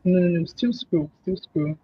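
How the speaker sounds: normal talking voice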